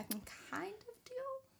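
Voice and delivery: whispered, resigned aside; whispering that she can cope. Real, not acted. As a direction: breathe it out to yourself.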